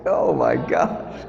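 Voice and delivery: low, slow, disgusted groan of oh my gosh. Retold or imitated. Preacher mimics someone near vomit, low slow disgust. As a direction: sink low and drag it out in disgust.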